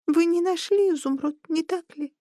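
tiny squeaky creature voice; thin high squeak, a little sad. A narrator's reading. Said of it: "пропищал он тоненьким голоском".

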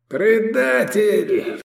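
high, long-drawn scream; drawn-out high wail; outraged howl. A narrator's reading. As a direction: howl the accusation, stretching it out. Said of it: "завопил Мирарзал".

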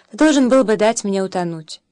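ordinary delivery, nothing marked

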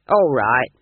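low, loud pirate swagger; a hint of a bragging bandit chief; gruff boastful bandit. A narrator's reading.

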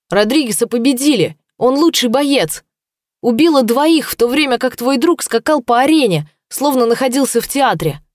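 desperate shouting. Acted. Choked werewolf protests desperately.

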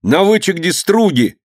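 put-on high, eager boyish call; sudden excited cry. Boy on the roof spots the boats, excited cry. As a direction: call out the news from above. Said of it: "вдруг крикнул".

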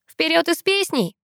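rally them with a high cry; acted bark. A narrator's reading. Sister urges the guest onward, mildly barking. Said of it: "гаркнула Ангелина".

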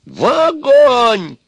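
very high, loud, stretched-out scream; enraged shriek. Acted. Baba Yaga condemns Ivan to the fire, shrieking. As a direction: scream it with all your rage.

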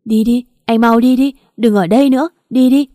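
loud, high, frantic shout; desperate high shout to drive him away. Acted. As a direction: scream at him to leave now. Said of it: "hét lên với Tùng".

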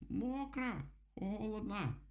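очень высокий протяжный вопль птицы; тяни слова тонким птичьим голосом, жалуясь; вопящий дятел. A narrator's reading. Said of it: "завопил он". (протяжно вопя, голосом дятла)

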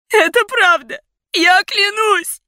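very high, loud cry; desperate screaming plea. A narrator's reading. Heroine pleading for Mason's life, desperate scream. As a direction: swear it in panic.

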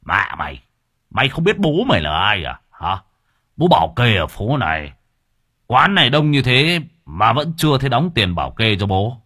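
loud thug threats; explosive rage. Acted. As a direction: shake him down, shouting. Thug shaking down shop owner.

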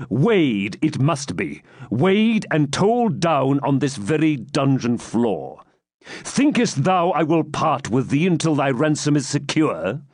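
slow, heavy commanding knight; stern knight. Acted. Baron dictating ransom terms, stern. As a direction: lay down the law coldly.

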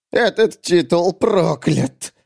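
high, slow, strained bitterness; bitter hiss. Acted. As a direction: squeeze it out slowly with loathing. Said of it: "прошипел Брешь Фластырь". (злобно процедить, растягивая слова)

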